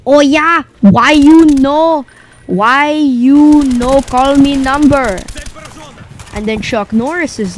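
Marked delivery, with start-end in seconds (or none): 0.1-5.2 higher, louder, slow mock broken English. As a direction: whine it out indignantly. Bobby confronts Chuck.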